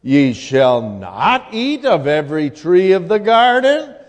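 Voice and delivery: usual speaking voice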